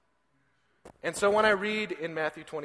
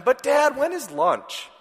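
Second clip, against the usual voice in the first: put-on much higher, louder little-boy plea; whiny toddler; 11 semitones higher, 7 dB louder, slower than usual. Father imitating his son asking for lunch, high whine. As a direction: nag for lunch like a small child.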